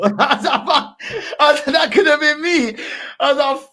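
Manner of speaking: high, loud laughing retelling; cracking up. Real, not acted. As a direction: laugh hard through the words. Cracking up recalling his escape.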